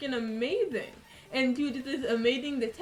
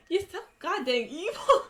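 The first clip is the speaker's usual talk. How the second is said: laughing, mock-outraged; laughing accusation; 5 semitones higher, 4 dB louder than usual. Real, not acted. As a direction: laugh while scolding. Chat demands she work out.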